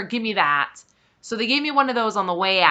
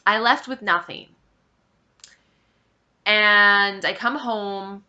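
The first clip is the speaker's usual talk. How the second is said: ordinary talk, nothing marked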